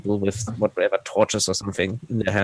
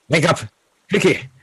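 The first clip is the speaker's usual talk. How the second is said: higher-pitched call to rouse people; urgent call; 5 semitones higher than usual. Acted. Player's character waking the others in the forest, urgent call. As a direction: rouse sleepers with a quick, raised call. Character rousing the camp guards.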